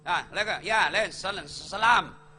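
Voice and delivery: mock mumbled half salam, swallowed syllables; lazy clipped salam. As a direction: slur the greeting lazily, words cut off. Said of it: "ndak ada yang sempurna salamnya".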